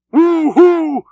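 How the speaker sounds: high whooping scream. A narrator's reading. The harpooneer urging the oarsmen on, high whooping scream. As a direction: whoop it wildly like a war cry.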